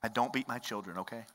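usual speaking voice

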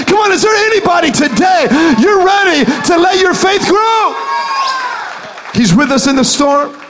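loud, impassioned sermon climbing in pitch; rally the congregation with rising fervor; building revival preacher cry; full-force preaching. Real, not acted.

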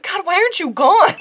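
high, loud, fed-up outburst; hammed-up impatient demand; rude exasperation. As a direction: imitate her at wits' end, exaggerated. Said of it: "I'm being ruder than she was".

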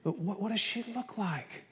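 soft, breathless question; weak and faint. Retold or imitated. New mother asking husband about baby, faint and weak. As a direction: ask it drained and soft. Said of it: "she's very weak".

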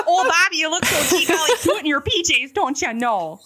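loud, high, thick Midwestern cooing; hammed-up Midwest mom impression; Bobby's mom, Midwest accent. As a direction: dote on a child in a broad Midwest accent. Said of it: "I sound like the mom from Bobby's World".

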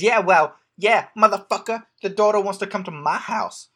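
hammed-up angry ex-wife; playfully angry mother on the phone; mock angry mother. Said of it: "she doesn't say that".

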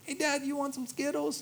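put-on high, bright little-kid voice, thanks rising; squeaky happy toddler thanking dad; toddler's delight. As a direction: play a thrilled two-year-old. Voicing toddler offering candy.

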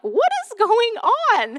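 high, drawn-out cry of disbelief; exasperated outcry. Retold or imitated. Mom reacting to her screaming daughter, exasperated. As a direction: stretch it out high, aghast. Retelling alarm at daughter's tantrum.

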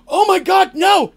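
high, loud cry of horror; horrified cry. Retold or imitated. Cole and Darsh seeing the liquor wasted, high horrified cry. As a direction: shriek it in high, loud dismay. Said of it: "they're like".

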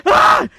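high shrieking yelp of fright; scared scream. Retold or imitated. Driver mimics his scared inner self with a shriek. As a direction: let out a sudden terrified squeal. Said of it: "the inner bitch in me was a little bit scared".

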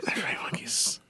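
hushed whisper echo. Pretend. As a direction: whisper it back conspiratorially. Echoes partner's whisper.

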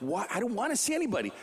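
high, fast mock yell; mock-yell it, quick and high; imitated yell. Jim refuses over the phone in a high mock yell. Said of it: "Jim's just yelling at him on the phone".

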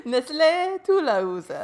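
hammed-up French Nestlé; bad Joey-style French; fake French accent. Continuing joke fake French.

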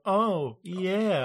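mock a racy workout tape; suggestive exercise-tape voice. Spoofing the partnered exercise tapes, suggestive put-on voice. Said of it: "And it's like".

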